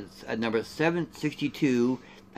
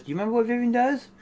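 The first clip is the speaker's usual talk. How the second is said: teasing quiz; 9 semitones higher, much faster than usual. Acted. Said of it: "teasing quiz to viewers".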